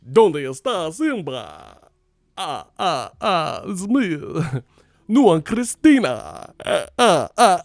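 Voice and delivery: slow, loud vampire count with a cackling laugh; Dracula voice. Retold or imitated. The Spanish-channel count, Dracula voice. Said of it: "sound like Dracula".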